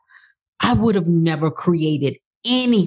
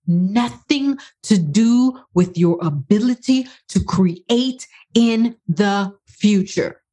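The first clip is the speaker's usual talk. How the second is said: slow, emphatic, word-by-word insistence; passionate conviction; slower than usual. Real, not acted. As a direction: drive it home passionately, drawing out each beat. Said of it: "I said it very passionately".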